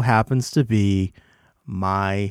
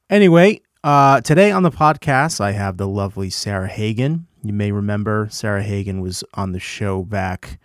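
ordinary talk, nothing marked

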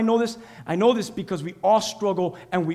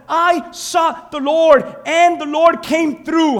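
higher, louder, stretched out; preached loud; 5 semitones higher, 6 dB louder, much slower than usual. Shown as a demonstration. Pastor re-preaches the psalmist's words, loud and triumphant. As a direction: proclaim it like a triumph.

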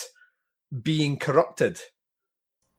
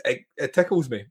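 usual speaking voice

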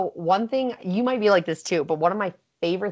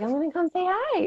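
sing-song tease; 12 semitones higher than usual. Retold or imitated. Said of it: "And I was like".

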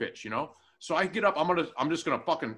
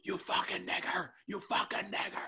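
higher, held-back imitation of a screamer; hushed mock yell; 4 semitones higher, 8 dB quieter than usual. Woman yelling in a diner's face, hushed mock yell. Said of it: "Starts yelling".

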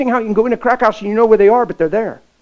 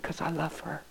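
tender murmur; 3 semitones lower, 17 dB quieter than usual. Retold or imitated. Tender confession to girl.

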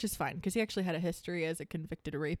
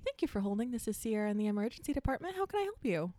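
customer service voice; 3 semitones higher, faster than usual. Shown as a demonstration. Showing a friend her phone voice, sweet and bright. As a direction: answer the hospital phone as sunny front-desk staff. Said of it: "when I answer the phone".

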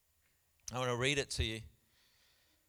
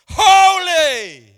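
huge high shout, drawn out; yelling; 14 semitones higher, 26 dB louder than usual. Acted. Preacher showing how the angels cried out, yelling. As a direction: yell it out to wake the room. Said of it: "you were yelling it".

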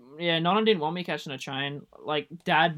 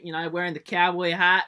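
ordinary talk

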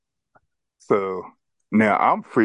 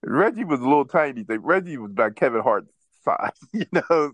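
ordinary delivery

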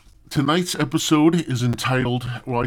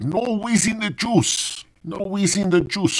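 badly faked Indian accent; 11 semitones higher than usual. Comic imitating a movie dude, clumsy high Indian accent. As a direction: quote a movie line in a bad Indian accent. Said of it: "I can't even do the fucking accent".

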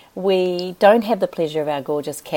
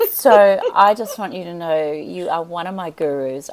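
ordinary talk

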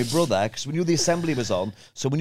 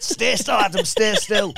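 put-on high, raised voice barking at the kid; a teacher losing patience, higher and sharper; angry teacher; 6 semitones higher, slower than usual. Teacher yelling at skinny Mark to stay still. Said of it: "he went".

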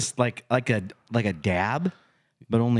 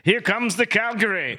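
superhero; 12 semitones higher than usual. Retold or imitated. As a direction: sing it out like a cartoon superhero. Punning on the superhero line.

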